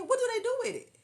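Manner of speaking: high, loud baffled outburst; pressing it at a high, bewildered shout; incredulous. Real, not acted. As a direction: squeal the question, truly stumped.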